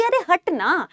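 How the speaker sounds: teacher acting out pushing Sweety away angrily; pushy mock anger. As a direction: shove her aside with a cross push. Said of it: "फिर आप धक्का बोलोगे".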